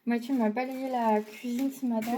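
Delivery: ordinary talk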